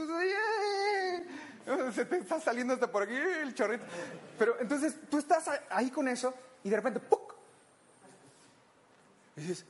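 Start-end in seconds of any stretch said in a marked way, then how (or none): 0.0-1.9 startled yelp. Retold or imitated. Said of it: "Y tú". 7.0-7.3 popping sound effect. Retold or imitated. Ear unblocking noise.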